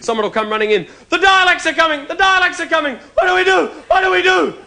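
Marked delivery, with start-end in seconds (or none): 1.1-4.6 mock panic. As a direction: squeal it like a kid fleeing Daleks. Child panicking about Daleks.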